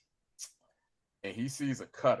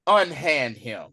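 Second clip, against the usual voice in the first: loud, slow retold command; lightly voiced heroine giving an order; commanding female rescuer; 8 dB louder, slower than usual. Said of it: "there was a female voice that said".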